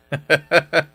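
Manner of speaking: short scornful laugh at her; mocking laugh. Husband scoffing at his wife's churchgoing, mocking laugh.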